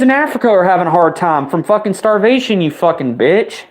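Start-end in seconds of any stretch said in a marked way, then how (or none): none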